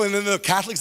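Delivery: normal talking voice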